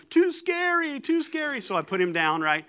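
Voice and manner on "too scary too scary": put-on much higher, child-like yell of "too scary"; imitating a frightened son crying out; mock little kid yell. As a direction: squeal it like a scared little boy. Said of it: "Juddy's yelling".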